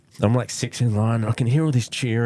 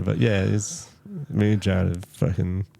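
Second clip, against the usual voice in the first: ordinary talk, nothing marked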